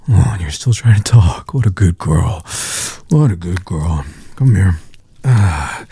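ordinary talk, nothing marked

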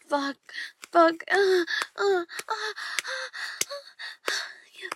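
climaxing with loud crying moans. Acted. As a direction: let the moans climb to a peak. Climax moaning, building.

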